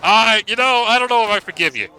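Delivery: mock sulky grudge. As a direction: play up the pretend grudge. Teasing the team with a mock grudge.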